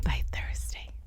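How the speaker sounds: low, whispered, voice nearly gone; hushed repeat. Real, not acted. Telling how sad she became, real hushed whisper. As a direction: let it sink to a soft whisper.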